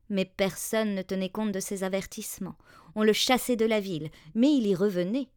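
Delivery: ordinary delivery, nothing marked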